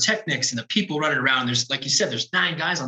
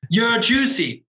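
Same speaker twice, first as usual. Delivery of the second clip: put-on Irish coworker's nickname line, in an Irish accent; imitating an Irish accent; 7 semitones higher, slower than usual. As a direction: do the Irish tech's line with a bright Irish lilt. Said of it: "from Ireland".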